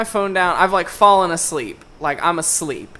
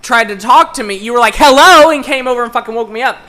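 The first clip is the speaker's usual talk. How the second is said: louder, higher angry rant; retold fury; 5 semitones higher, 7 dB louder than usual. Venting to a friend about being woken, angry rant. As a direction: let the anger spill out. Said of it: "I was so mad about it".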